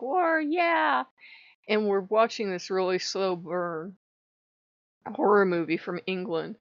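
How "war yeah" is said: high excited battle cry; gleeful shout; eager war cheer. Retold or imitated. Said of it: "he's like".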